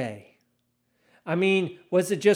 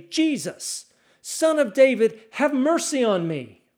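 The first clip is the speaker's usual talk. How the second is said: raised, higher-pitched cry for mercy; shouting out; 6 semitones higher, 4 dB louder than usual. A narrator's reading. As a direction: call out as a beggar over a crowd. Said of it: "he began to shout out".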